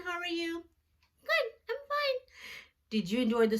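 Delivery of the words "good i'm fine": much higher puppet voice for a little friend; Jackson puppet voice. Pretend. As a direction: give the puppet a high childlike voice. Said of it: "our friend Jackson".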